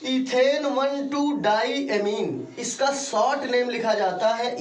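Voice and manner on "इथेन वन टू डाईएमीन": इथेन वन टू डाईएमीन slow and raised, word by word; emphatic slow dictation. Shown as a demonstration. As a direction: spell it out slowly for struggling pupils. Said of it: "वो लोग ध्यान देंगे". (ज़ोर देकर धीरे-धीरे समझाते हुए)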